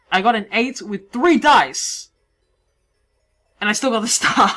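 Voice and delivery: ordinary talk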